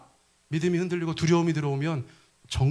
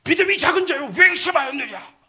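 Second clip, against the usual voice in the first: much higher, louder rebuke; Jesus scolding Peter with a loud shout; 11 semitones higher, 5 dB louder than usual. Shown as a demonstration. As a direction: thunder it down as a stern scolding. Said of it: "이렇게 하시겠어요?".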